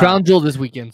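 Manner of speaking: loud, insistent announcement. Real, not acted. Repeats it louder, insisting.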